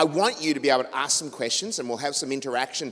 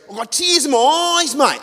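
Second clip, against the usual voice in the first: much higher, louder, choked-up bloke voice; teary trucker fan; 13 semitones higher, 9 dB louder than usual. Retold or imitated. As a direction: overcome trucker gushing down the phone. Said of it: "And he goes".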